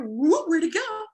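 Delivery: high, slow, drawn-out mock wonder; playful hiding game with the dog; mock surprise. Shown as a demonstration. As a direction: pretend the toy vanished. Playing peekaboo with dog.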